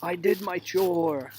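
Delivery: mock whiny kid voice, slow; lightly voiced kid demanding praise; half-hearted spoiled child; entitled kid. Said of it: "You're like".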